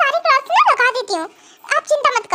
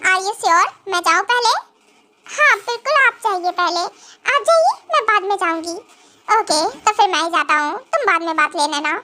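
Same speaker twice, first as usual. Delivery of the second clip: grumpy husband; 7 semitones lower than usual. Acted. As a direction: lower stern husband voice. Husband figure held voice. (नाराज़ पति, हल्की नीची आवाज़)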